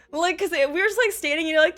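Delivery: normal talking voice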